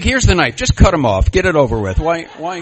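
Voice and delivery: a loud, hammed-up cry of defeat; mock anguished outburst. A comic telling his meeting how it felt, loud and hammed-up. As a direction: belt it out like a man begging to be put out of his misery. Retold despair at being friend-zoned.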